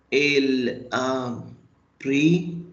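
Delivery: ordinary talk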